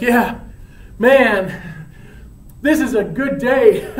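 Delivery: high, loud, laughing delight; elated, with laughs breaking in; giddy happiness. Acted. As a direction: gush like a blissed-out buddy. Giddy newlywed Romeo hides his news.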